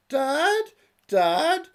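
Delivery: very high, loud pleading daughter calling Dad; high girlish plea; squeaky daughter. Acted. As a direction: whine it up like a lovesick girl. Daughter calls her father in song.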